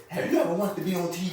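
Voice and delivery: brisk mock commercial pitch; radio commercial voice. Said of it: "we heard a commercial".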